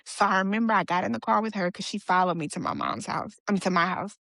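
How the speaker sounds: ordinary talk, nothing marked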